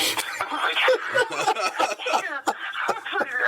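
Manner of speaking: real laugh up high; laughing hard, high; high cackling laugh. Real, not acted. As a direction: burst out laughing at the memory. Laughs at own story.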